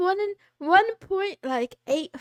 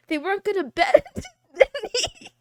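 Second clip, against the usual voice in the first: laughing; 6 semitones higher, 3 dB louder than usual. Real, not acted. Laughing mid sentence.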